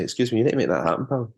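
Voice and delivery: a hint of a stern Scottish demand, fast; lightly put-on Scottish, quick and insistent; quick Scottish demand, only lightly voiced; Scottish accent. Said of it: "use this accent and go".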